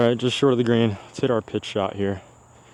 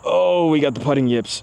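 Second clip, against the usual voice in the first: loud, higher groan of frustration; frustrated exclamation; 6 semitones higher, 5 dB louder than usual. Real, not acted. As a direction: complain about the miss loudly. Missed putt exclamation.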